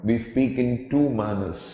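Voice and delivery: slow it down and hit "two"; careful classroom English, slow; slow English with stress. Shown as a demonstration. Teacher slows for English line.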